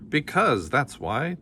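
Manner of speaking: mild mock snap; snapped. A narrator's reading. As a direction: cut the question off like an annoyed king. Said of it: "the king snapped".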